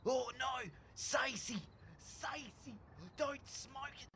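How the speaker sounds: high pleading yells; begging her not to smoke it; calling out. Acted. Calling after Stacey.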